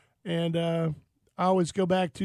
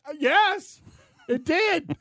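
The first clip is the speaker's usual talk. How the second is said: high-pitched, strained mock yell; re-enacted shocked outburst; 9 semitones higher, slower than usual. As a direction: answer as if still being zapped. Shocked son answering his dad.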